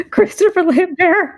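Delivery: high, laughing echo of the name; laughing disbelief. Real, not acted. Incredulous at the named actor.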